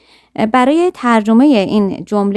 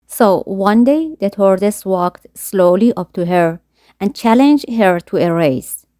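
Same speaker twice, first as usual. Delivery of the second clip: slow story reading; much slower than usual. A narrator's reading. Reads story sentence slowly.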